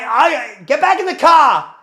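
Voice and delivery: loud, high-pitched command; re-enacted shouted order. Brother ordering his sister to the car, acted shout. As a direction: shout the order at full force. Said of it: "I'm like this to Megan".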